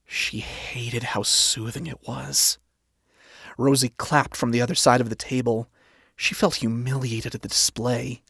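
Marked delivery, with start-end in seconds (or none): none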